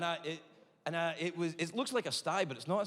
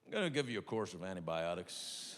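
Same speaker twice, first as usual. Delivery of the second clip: lower, softer deadpan doctor; deep doctor voice; 9 semitones lower, 4 dB quieter than usual. Retold or imitated. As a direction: drone it like a bored doctor.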